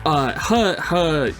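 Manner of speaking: usual speaking voice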